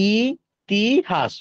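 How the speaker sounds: ই, তি, হাস as three separate syllables. Shown as a demonstration. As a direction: break the word into ই / তি / হাস with pauses. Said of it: "তিনটে দল পেলাম".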